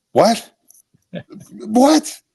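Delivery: high, rising disbelief; incredulous dad. Retold or imitated. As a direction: imitate a father who can't believe his ears. Acts out dad's shocked reply.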